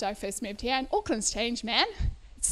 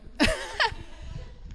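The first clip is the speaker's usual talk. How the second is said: laugh. Real, not acted. Laughs after inviting accent.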